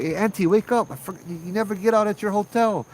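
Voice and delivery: pitched high, calling over the shoulder; high call back toward the rear seat; calling back to wake her. Retold or imitated. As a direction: call out to rouse a dozing passenger. Waking a sleeping passenger behind.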